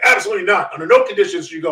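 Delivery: loud, forceful retold prohibition; emphatic refusal. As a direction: forbid it as an alarmed official, loudly. Official flatly forbids the trip, retold.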